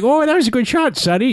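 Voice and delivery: put-on sweet old grandma praising a shot. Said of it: "going to do a grandma voice".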